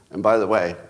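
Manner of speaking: ordinary talk, nothing marked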